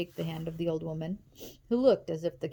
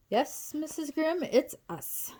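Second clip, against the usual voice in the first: forced sweetness; 9 semitones higher, slower than usual. A narrator's reading. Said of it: "forcing her usual frown into a smile".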